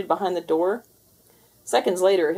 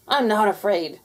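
snappish; 3 semitones higher, 5 dB louder than usual. Acted. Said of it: "snapped Garfield".

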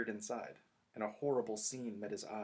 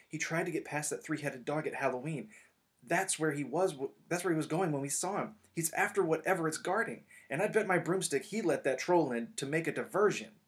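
higher and louder, urgent rush; breathless excitement; 6 semitones higher, 6 dB louder than usual. Acted. Harry telling friends Snape is after the dog, excited. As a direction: spill the discovery in a rush. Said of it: "he finished breathlessly".

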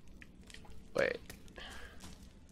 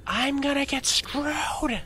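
mock panic; 12 semitones higher, slower than usual. Real, not acted. Panicking in game.

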